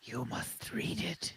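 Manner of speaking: soft slow whisper; whispery dragon voice. Acted. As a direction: hush it out like a tiny drake. Drake answers player.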